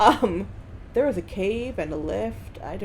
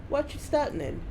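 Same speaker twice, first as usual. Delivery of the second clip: higher, hammed-up Southern character voice; worsening Southern accent; 7 semitones higher than usual. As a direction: let the fake Southern accent slip further. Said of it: "Just getting worse".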